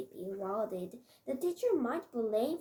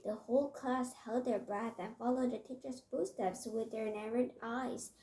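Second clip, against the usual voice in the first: usual speaking voice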